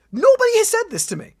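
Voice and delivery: nearly shouting that nobody told her; incredulous outburst. Real, not acted. To the commenter, an incredulous, outraged outburst.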